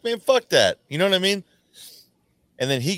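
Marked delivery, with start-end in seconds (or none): 0.0-0.8 high-pitched indignant outburst; pissed for him. Retold or imitated. Friend siding with the dumped man, pissed for him. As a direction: get indignant on his behalf, pitched up. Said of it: "like, pissed for him".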